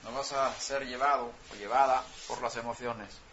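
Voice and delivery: ordinary delivery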